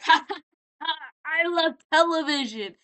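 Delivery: high, loud screechy laugh and cry; screeching. Retold or imitated. TV guy announces himself, loud high mock screech. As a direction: imitate a screeching TV character. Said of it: "we just hear this screeching noise".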